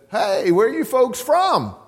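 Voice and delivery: a chatty stranger calling hey to diners; old man's friendly greeting. Retold or imitated. Old man to the couple at dinner, lightly voiced hearty greeting.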